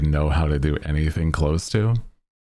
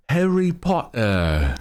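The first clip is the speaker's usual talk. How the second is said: higher, slow British accent on Harry Potter; mock British accent; 10 semitones higher, slower than usual. Shown as a demonstration. Streamer tries a British accent, drawn out slowly. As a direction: draw out Harry Potter like a posh Brit. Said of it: "actual British dialect".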